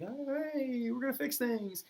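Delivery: high, soft, chirpy mock of the hopeful Third Estate; naive hopeful commoners. Third Estate entering, mocked.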